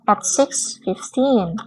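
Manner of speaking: very slow, careful American way; slow American reading. Shown as a demonstration. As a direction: read it out slowly as Americans say it. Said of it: "mereka bacanya". (dibaca sangat pelan gaya Amerika)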